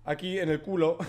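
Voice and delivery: ordinary delivery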